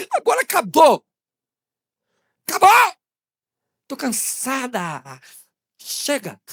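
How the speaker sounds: high loud cry sinking to weary complaint; fed-up outburst. Acted. Upset girlfriend home to her mother, fed-up outburst. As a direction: burst out, then run out of steam. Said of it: "chega em casa e fala pra mãe".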